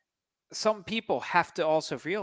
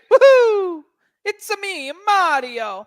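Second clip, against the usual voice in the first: high, loud Woohoo in a Mario voice; Mario impression; 9 dB louder, slower than usual. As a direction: mimic the plumber's cheer. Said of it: "all he does is say".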